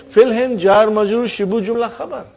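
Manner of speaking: firm reminder to a student who missed it; repeating the parsing with emphasis; insistent repeat. Real, not acted. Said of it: "I just said".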